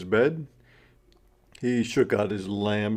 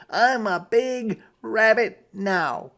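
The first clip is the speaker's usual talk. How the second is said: put-on high, fast child's voice; quick high kid voice, proud; little rabbit voice; 9 semitones higher, 4 dB louder than usual. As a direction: insist you're grown up, like a little kid. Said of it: "said Jasper".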